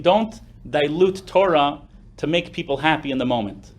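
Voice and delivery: ordinary talk, nothing marked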